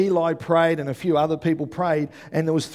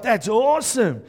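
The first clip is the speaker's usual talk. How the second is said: acted delight; 7 semitones higher, 4 dB louder than usual. Acting own delighted reaction.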